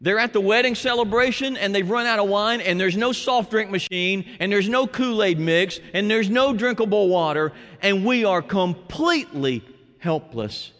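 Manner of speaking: usual speaking voice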